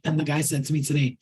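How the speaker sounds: ordinary talk, nothing marked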